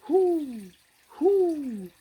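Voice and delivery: high, loud owl hoots. Shown as a demonstration. To a child, a hammed-up loud owl call. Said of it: "something that goes".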